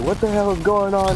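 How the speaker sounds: high-pitched alarmed mom; squeaky mock panic as mom; hammed-up mom freaking out. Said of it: "my mom's like".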